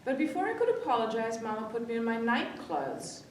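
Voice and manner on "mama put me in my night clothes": ordinary delivery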